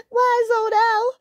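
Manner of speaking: put-on high, slow American-accented kitten; squeaky kitten turned American. A narrator's reading. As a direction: slip into a drawling American kitten voice. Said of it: "Why are you American?".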